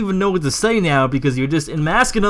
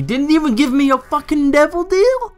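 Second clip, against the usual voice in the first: irritated high voice; 12 semitones higher, 3 dB louder than usual. Real, not acted. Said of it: "how my voice got higher".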